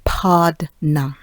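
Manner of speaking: put-on "partner" as pardna, Jamaican accent. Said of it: "as she would call it with her strong Jamaican accent".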